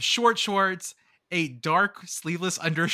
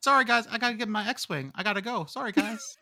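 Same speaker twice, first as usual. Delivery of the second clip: George Lucas impression; 3 semitones higher than usual. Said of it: "I'm George Lucas".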